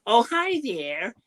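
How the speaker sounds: very high, squeaky beaver voice; a hammed-up American beaver, drawn out; Beaver puppet. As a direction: play a little puppet beaver with a bad American accent. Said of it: "I did this terrible American accent".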